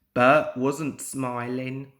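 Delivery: ordinary delivery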